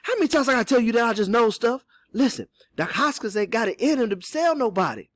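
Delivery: high, loud dialect character voice; exasperated Cato. Acted. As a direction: insist as if tired of repeating it.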